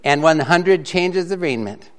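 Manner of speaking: lively, loud storytelling read; comic story reading. Acted. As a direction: read the mixed-up Bible tale for laughs. Reads a comic mixed-up Bible story.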